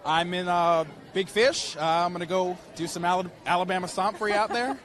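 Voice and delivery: loud Southern accent, performed on request. Shown as a demonstration. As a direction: give them your best Southern accent, loud and proud. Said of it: "can you give us a little Southern accent".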